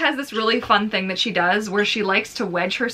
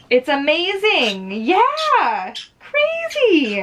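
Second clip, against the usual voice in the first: much higher, louder gushing; gushing delight; 8 semitones higher, 3 dB louder, much slower than usual. Real, not acted. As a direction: squeal with delight. Gushing over the bird.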